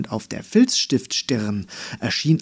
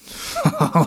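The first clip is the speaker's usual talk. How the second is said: mocking giggle; 6 semitones higher than usual. Flowers laughing.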